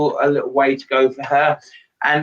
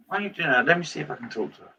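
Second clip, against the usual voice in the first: quiet aside; 8 dB quieter, much faster than usual. Real, not acted. Aside away from the call.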